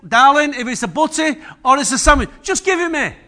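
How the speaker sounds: fed up, asking for the sandwich; half-hammed impatience; exasperated retort. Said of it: "I said".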